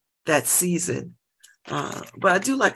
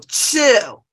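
firm chill; 5 semitones higher, 5 dB louder than usual. Real, not acted. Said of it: "telling Iranians to calm down".

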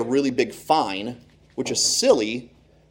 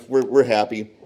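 ordinary talk

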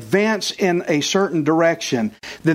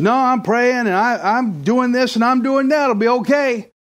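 high stubborn refusal; 5 semitones higher than usual. Retold or imitated. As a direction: wave them off higher and more insistent. Man refuses boat.

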